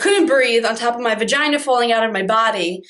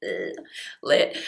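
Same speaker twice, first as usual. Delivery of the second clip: whispered, lazy mock thrill; breathy mock delight; 7 dB quieter than usual. Given the pill in the hospital, a whispered lazy thrill. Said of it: "I'm like".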